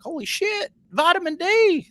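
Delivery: thrilled surprise, voice jumping high; excited greeting. Retold or imitated. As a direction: greet an old friend you haven't seen in years. Greeting old friend in story.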